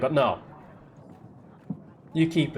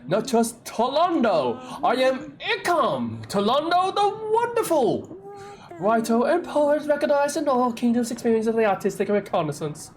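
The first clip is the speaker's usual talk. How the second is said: pompous poet; 9 semitones higher than usual. Acted. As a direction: announce himself grandly like a vain bard. Poet boasting his titles.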